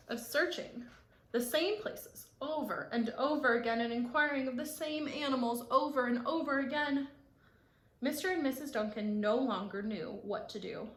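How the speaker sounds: ordinary delivery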